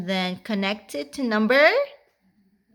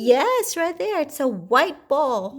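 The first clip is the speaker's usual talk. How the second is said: put-on reading to a toddler; 5 semitones higher than usual. Pointing out ball to toddler.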